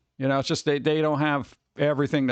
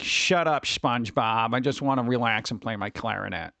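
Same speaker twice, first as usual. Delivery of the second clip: Squidward grumble; 8 semitones lower than usual. Retold or imitated. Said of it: "we're like the Squidward".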